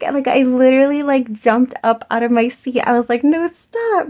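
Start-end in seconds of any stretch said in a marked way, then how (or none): 3.2-4.1 high, delighted no-way squeal; thrilled reply to the girl being seventeen; enthusiastic disbelief. Retold or imitated. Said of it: "I was so enthusiastic".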